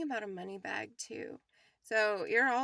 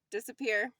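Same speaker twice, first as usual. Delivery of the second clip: normal talking voice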